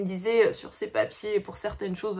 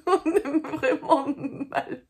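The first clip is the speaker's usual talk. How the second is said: laughing; 5 semitones higher, slower than usual. Real, not acted. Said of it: "Si je continue de rire".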